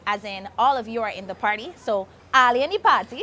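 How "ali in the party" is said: Trini accent, pati with no r; Trinidadian accent. Shown as a demonstration. Host repeats the Trinidad saying in Trini accent. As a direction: give it in full Trinidadian. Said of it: "In Trinidad, they say".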